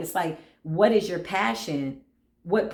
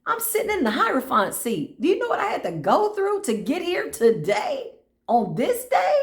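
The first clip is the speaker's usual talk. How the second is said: much higher, incredulous; mock outrage, higher and higher; indignant disbelief; 10 semitones higher than usual. Indignant rant about energy.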